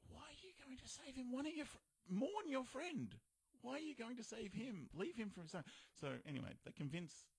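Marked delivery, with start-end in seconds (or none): 0.1-5.6 high-pitched, incredulous protest; can't believe they'd be so foolish; exasperated pleading. Retold or imitated. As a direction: plead with them, pitch right up. Exasperated viewer pleading with the toys.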